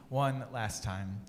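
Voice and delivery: ordinary delivery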